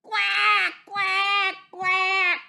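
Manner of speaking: loud, high, squawked quacks; piercing high duck cries; enraged duck quacking. A narrator's reading. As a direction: squawk in a duck's rage. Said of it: "Miss Duck flew into a rage".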